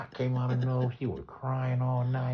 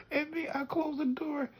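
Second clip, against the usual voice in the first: usual speaking voice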